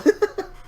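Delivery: a short laugh jumping way up; high delighted laugh. Real, not acted. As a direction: laugh at the punchline. Laughs at Carrey anecdote.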